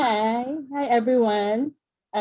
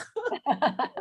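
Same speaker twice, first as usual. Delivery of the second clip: short incredulous laugh, higher; disbelieving laugh; 9 semitones higher than usual. Retold or imitated. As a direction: laugh as if to say you can't be serious. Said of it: "So we were like".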